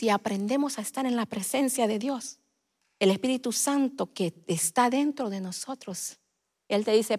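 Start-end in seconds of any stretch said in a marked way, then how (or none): none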